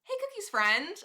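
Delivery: high bright hello to a stranger; cheery high hello to Cookie's friend; testimonial greeting. Pretend. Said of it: "I can make a testimonial for your friend".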